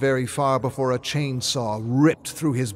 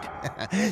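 soft villain giggle; 11 semitones higher, 6 dB quieter than usual. Acted. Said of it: "a peal of laughter".

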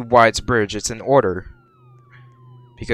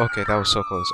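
usual speaking voice

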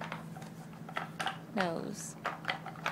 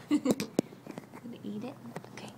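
whisper; 7 dB quieter than usual. Real, not acted. Whispered tease to baby.

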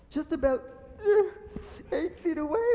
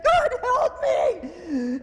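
high, loud, very slow pretend cry; drawn-out mock plea, hammed up; cry for help; 11 semitones higher, 9 dB louder, much slower than usual. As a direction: Eve calling on God, a high mock cry. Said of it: "She just should have said".

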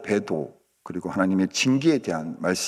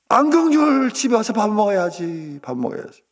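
put-on calling a child home; 12 semitones higher, 4 dB louder than usual. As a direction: holler the kids in for supper. 부모가 아이를 밥 먹으라고 부름. (밥 먹으라고 길게 부르는 소리)

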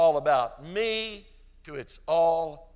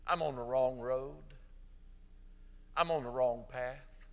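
tender altar call; 2 semitones lower, 5 dB quieter than usual. Acted. Pastor inviting listeners to pray at home, soft and pleading. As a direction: draw them gently into prayer.

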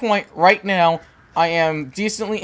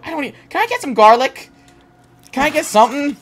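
higher, louder anxious begging; anxious pleading; 6 semitones higher, 5 dB louder than usual. Real, not acted. Gamer begs the game for a weapon against the vampire: anxious higher pleading. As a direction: plead for anything to fight with.

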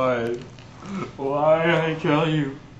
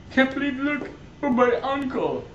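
higher, softer voice of disbelief; emotional recognition; 6 semitones higher, 5 dB quieter than usual. Acted. Nephew realising the villain is his uncle, higher pitched. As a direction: plead it, voice lifted in shock.

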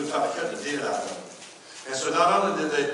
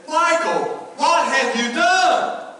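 much higher and louder, slow, drawn-out cry of dismay; shocked yell; 12 semitones higher, 9 dB louder, much slower than usual. Retold or imitated. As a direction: shout at him over the ruined bushes. Pastor confronting worker over bushes.

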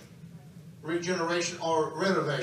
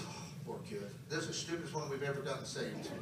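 low, soft muttered verdict; hushed, hammed-up pity; mock pitying aside; 8 semitones lower, 12 dB quieter, faster than usual. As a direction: say it under your breath, low and quick. Voices onlookers pitying him to Jesus.

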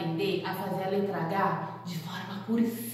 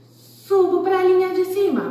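higher and louder climb; excited; 9 semitones higher, 8 dB louder than usual. Shown as a demonstration. Teacher traces the capital H for kids, excited. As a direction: lift the voice as the pen climbs. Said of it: "tracing big H".

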